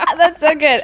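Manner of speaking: laughing through the praise. Real, not acted. Host still laughing over her guest's words. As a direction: still giggling with delight.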